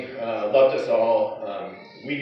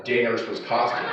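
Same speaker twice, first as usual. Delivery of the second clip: normal talking voice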